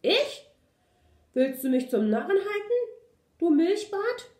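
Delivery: loud, high-pitched indignant dog; angry shout. A narrator's reading. Big dog scolding the puppy, angry shouting. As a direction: yell it in outrage at the puppy. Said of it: "schrie der Hund".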